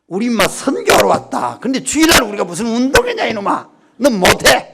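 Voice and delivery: loud, firm telling-off of a son; stern scolding. Retold or imitated. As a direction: lay down the law to your boy. Father forbids son sports day.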